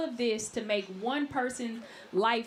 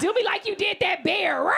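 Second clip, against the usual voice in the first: loud, high hammed-up growl; lusty shout; 7 semitones higher, 5 dB louder than usual. Comic fantasizes about DiCaprio, loud hammed-up roar. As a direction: roar it out, over the top. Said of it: "I'd be like".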